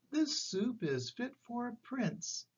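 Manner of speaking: put-on high, slow, delighted old lady. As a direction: savor the praise slowly, old lady. Old lady praises soup.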